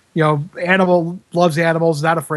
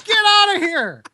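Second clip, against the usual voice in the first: imitated yelling. Imitating assistant yelling at mice in the box, high shout. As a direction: shout them out of the place at high pitch. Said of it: "just starts yelling at him".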